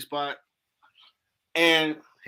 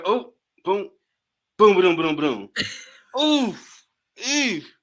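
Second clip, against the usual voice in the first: imitated tumbling noises; 3 semitones higher, slower than usual. Man replaying his fall down the stairs, imitated tumbling noises. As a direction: act out a bumpy fall down stairs. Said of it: "And you just hear".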